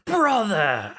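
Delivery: high, dramatic video game villain; Liquid Snake voice. Retold or imitated. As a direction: cry it out like a theatrical villain. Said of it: "Liquid Snake voice".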